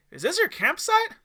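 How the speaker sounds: put-on high, loud curious child; child excited at the campfire, voice high; eager kid question. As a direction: ask it bright and high. Excited kid asks guest.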